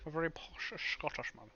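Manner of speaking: posh Scottish accent; Sean Connery. Shown as a demonstration. Game master agreeing to try the dwarf voice, posh Scottish accent. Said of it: "I think I could do Sean Connery".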